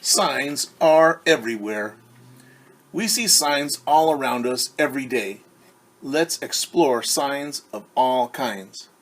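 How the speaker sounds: bright storybook reading voice; reading to children. Acted. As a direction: read the page out to little ones. Reads the book aloud.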